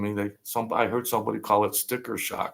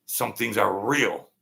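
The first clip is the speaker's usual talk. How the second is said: ordinary talk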